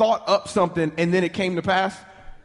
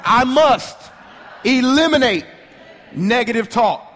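louder, forceful, each word hammered; with power; 3 semitones higher, 5 dB louder than usual. Shown as a demonstration. Pastor leading the crowd, now said with power. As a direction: shout it out with conviction. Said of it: "Now say it with power".

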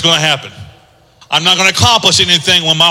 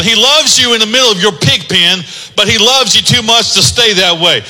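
ordinary talk

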